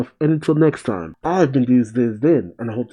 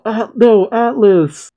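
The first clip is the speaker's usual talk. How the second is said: high, louder mock cry of horror; dismayed cry; 9 semitones higher, 4 dB louder, slower than usual. People watching their champion get struck, high dismayed cry. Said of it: "As the people cry out".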